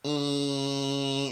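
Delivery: high thin "Ee" like an electric bike; mosquito whine. Retold or imitated. Mocking the electric bike, a high thin whine. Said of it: "my electric bike goes".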